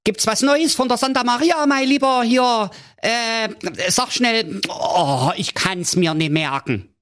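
very high, hurried king voice, impatient; high-pitched royal fusspot rushing the servant; fussy king. A narrator's reading. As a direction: squeak it out like a flustered little king. King voice in fairy tale.

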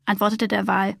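usual speaking voice

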